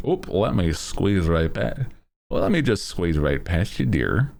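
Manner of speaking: put-on quick folksy Midwestern accent; hammed-up Upper Midwest accent, rattled off. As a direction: squeeze by like a polite Midwesterner. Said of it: "same with the Mis-, Midwesterns".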